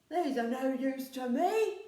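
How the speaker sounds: loud, dismissive troll; a troll shrugging off worthless gold. A narrator's reading. Troll tossing away gold, loud and dismissive. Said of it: "he said".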